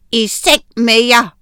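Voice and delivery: loud stern bark; shouting. A narrator's reading. Strict teacher shouting at the children, loud. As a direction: yell them off to bed. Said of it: "råbte frøken Skrap".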